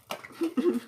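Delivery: short, high chuckle; laugh. Real, not acted. Unboxer finding the box all battered, laughing. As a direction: let out a brief, high-pitched laugh.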